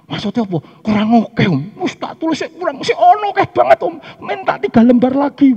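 high, loud eager voice of a husband. Retold or imitated. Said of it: "ono sing angkat tangan".

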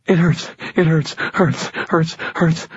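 put-on high, strained girl's voice, panting hurts; panting in pain. A narrator's reading. Injured girl pants to the narrator in pain, high and strained. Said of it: "she panted".

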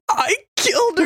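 retold confession breaking into giggles; laughing confession. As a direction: squeak it out between laughs. Retelling Danny's laughing confession.

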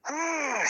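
high, loud frustrated "Ah!"; retold angry scream. Caller mimics partner losing it, high frustrated scream. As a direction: imitate an exasperated shriek. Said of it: "She's so mad".

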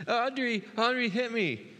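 put-on high, whiny little-girl tattling; mimicking his daughter blaming her sister; imitating toddler. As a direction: squeak it like a tattling three-year-old. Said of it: "she goes".

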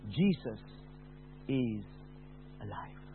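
soft, fading to almost nothing; a tender voice from nowhere; quiet gentle voice. Retold or imitated. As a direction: speak it gently, barely above a breath. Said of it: "beautiful, quiet voice saying".

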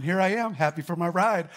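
high, loud fake cheer; mock cheerful. Pastor mimicking Carl faking cheer at the corral, high and loud. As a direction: pretend everything's fine, brightly. Carl pretending all is fine.

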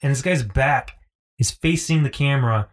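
ordinary talk

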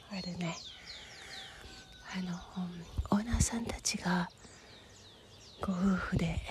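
hushed, fading whisper. Real, not acted.

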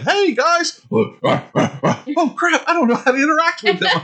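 very high comic panic; cheery greeting sliding into high fluster; gorilla among gorillas. Retold or imitated. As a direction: play a socially lost gorilla, high-pitched. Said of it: "he's like".

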